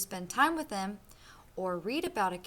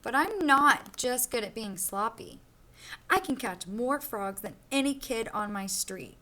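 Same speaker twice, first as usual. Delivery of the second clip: lively storybook read-aloud for a class; reading to children; 3 dB louder than usual. Acted. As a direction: read it like a picture book to kids. Teacher reads picture book aloud.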